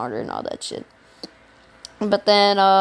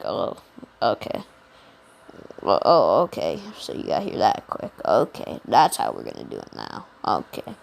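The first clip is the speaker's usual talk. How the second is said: Missus Aizawa done much lower and softer; deep teacher voice; 14 semitones lower, 3 dB quieter than usual. Retold or imitated. Teacher to the student who got there fast, deep and low. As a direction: drop way down low and unimpressed.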